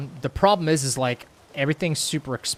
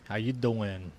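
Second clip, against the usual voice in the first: smooth, slow flirty pickup line; redoing the Joey line for chat, flirty; Joey Tribbiani; 3 semitones lower, 3 dB quieter, slower than usual. Shown as a demonstration. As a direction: lean in like a charmer at the coffee shop. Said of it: "why did I read that in Joey Tribbiani's voice".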